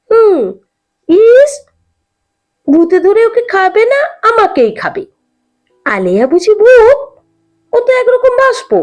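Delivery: put-on high-pitched, taunting girl's retort; mocking. A narrator's reading. Kumi taunts Hiru that ghosts won't eat her, mocking. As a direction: mimic a scornful know-it-all, pitched well up. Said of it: "Kummi ভেঙচি কেটে বলেছিল".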